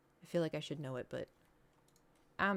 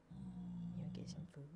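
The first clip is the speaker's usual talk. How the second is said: low whispered offer of food; whisper to pet; 11 dB quieter than usual. Real, not acted. Owner whispers an offer of food to her pet. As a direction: hush it to the pet, rising at the end.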